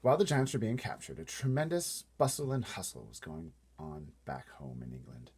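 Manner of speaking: ordinary delivery, nothing marked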